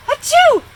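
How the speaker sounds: loud, high sneezes in a row. A narrator's reading. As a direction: sneeze hard three times. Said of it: "They make me sneeze".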